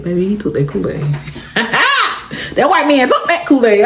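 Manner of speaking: high, laughing disbelief; can't believe he took it, laugh through it; tickled telling the Kool-Aid story; amused disbelief. Real, not acted.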